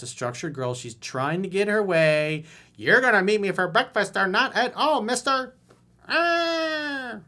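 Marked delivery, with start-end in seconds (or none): 2.8-5.6 high, loud scolding mock voice; mock bossy woman. Coach mocking the woman's demand, high and scolding. Voicing her domineering demand. 6.1-7.2 mock wail. Mock cry.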